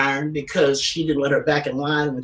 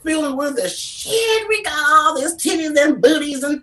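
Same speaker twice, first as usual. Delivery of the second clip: excited gushing; 10 semitones higher, 3 dB louder than usual. Real, not acted. Gushing about [name] and [name].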